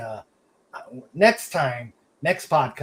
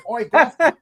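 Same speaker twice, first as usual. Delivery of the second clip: much higher, louder laughing mock Cockney; British imitation; 11 semitones higher, 10 dB louder than usual. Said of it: "a pretty good Brit, uh, imitation".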